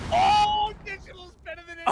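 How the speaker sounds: high, fast, loud outburst; excited shout. Real, not acted. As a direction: yell out the big play. Caster reacting to a big play.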